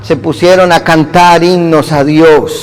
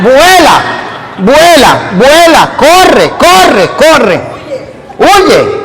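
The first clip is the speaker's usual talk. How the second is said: excited urging; 6 semitones higher, much slower than usual. Acted. Comic escape, urging to run.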